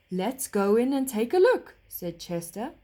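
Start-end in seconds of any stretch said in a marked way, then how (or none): 0.1-1.7 gentle horse voice. Acted. Horse speaks.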